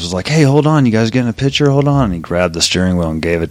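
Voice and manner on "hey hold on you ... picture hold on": put-on cheerful call to the kids; quoting a friendly guy. Quoting a guy at car show.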